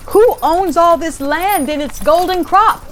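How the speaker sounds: high, lightly voiced king calling out; a hint of a king calling from his carriage. A narrator's reading. King calls to the reapers, lightly voiced call. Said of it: "the king called".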